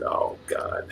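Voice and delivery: breathy whisper under the breath; whispered dismay. Retold or imitated. Dismayed aside seeing the ladies.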